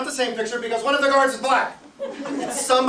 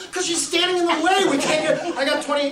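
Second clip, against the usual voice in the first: high, exasperated re-enacted yelling; heated retort, pitched well up; 7 semitones higher, 4 dB louder than usual. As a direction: shout a self-justifying answer. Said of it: "Why are you yelling at Joanne?".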